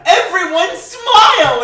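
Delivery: high, loud, slow bubbly cheer; perky chirpy voice. Retold or imitated. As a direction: beam it out like a sunny optimist. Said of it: "Kimmy Schmidt is like".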